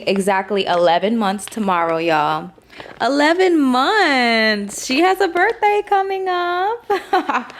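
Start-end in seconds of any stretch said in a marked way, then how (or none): 3.0-7.5 high, slow gushing over the puppy. Real, not acted. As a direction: coo it out, delighted.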